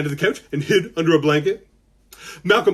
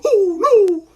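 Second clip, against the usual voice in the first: very high, loud mock-frightened wail; scared little brother; 7 dB louder than usual. Showing kids how little brother gets scared, high frightened wail. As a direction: wail it like a terrified little kid. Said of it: "he's gonna get scared".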